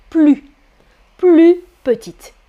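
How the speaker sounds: "plus" said as plu, no S; teacher models "plus" ending on the u vowel; silent final S. Shown as a demonstration. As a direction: say "plus" without its S before "petite". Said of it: "une consonne, je dis".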